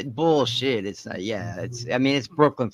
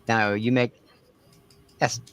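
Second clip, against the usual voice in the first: ordinary talk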